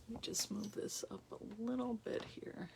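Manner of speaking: whispered under the breath while fiddling; a quick whispered aside. Real, not acted. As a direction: drop to a whisper, just for yourself. Aside while adjusting camera.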